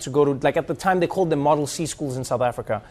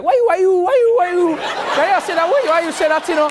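much higher and louder rapid Haitian Creole-flavored English; heavy Haitian accent; 13 semitones higher, 5 dB louder than usual. Retold or imitated. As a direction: fire back like a friend fully back in Haiti. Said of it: "when he's in Haiti".

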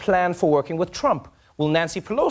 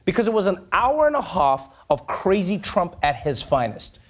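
ordinary delivery

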